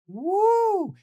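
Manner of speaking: long, piercingly high woo; loud high whoop. Real, not acted. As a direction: whoop loudly in admiration. Whoop for [name].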